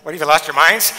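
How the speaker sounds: loud, exasperated scolding; exasperated Paul. Retold or imitated. Paul scolding the Galatian church, exasperated and loud. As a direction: scold them as if they'd lost their minds. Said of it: "Galatians, it's like".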